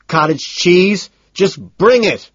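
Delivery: high, drawn-out question into a sharp order; exasperated demand. A narrator's reading.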